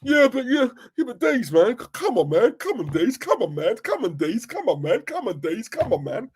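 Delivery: high, nagging "come on" over and over; whining fan. Retold or imitated. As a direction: needle him like a moaning fan. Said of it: "Then what you get is".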